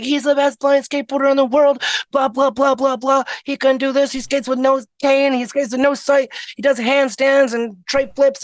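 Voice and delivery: high-pitched, rapid-fire boasting on someone's behalf; gushing friend. Retold or imitated. Friend bragging to the booth guy about the blind skater, gushing. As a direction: imitate an excited friend talking him up.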